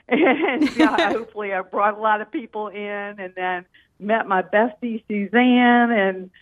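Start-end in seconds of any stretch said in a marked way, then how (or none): 0.1-2.8 laughing. Real, not acted. Caller laughs at herself.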